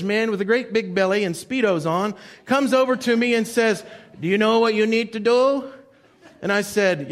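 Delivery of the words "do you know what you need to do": a light imitation of a know-it-all stranger; pushy stranger. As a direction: play the bossy beach guy, lightly. Pushy stranger voiced in story.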